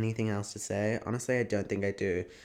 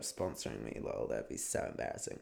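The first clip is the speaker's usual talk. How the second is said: whispered aside; 12 semitones lower, 11 dB quieter than usual. Real, not acted. Embarrassed aside about sponsorship.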